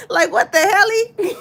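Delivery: a very high, loud line cracking up with laughter; laughing. Real, not acted. Vlogger laughing at her own Shelly pun to viewers. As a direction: laugh right through the words.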